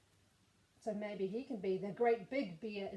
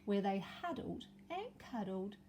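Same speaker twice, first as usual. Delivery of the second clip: story narration; 2 semitones higher, 4 dB louder than usual. A narrator's reading. Story finale for children.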